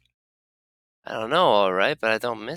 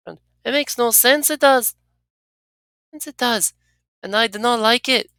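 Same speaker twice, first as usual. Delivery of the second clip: higher, confused and uneasy character voice; companion Anna voice; 12 semitones higher, 4 dB louder than usual. Acted. Anna admitting confusion to the player, higher and uneasy. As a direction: puzzle over why you followed, pitched up.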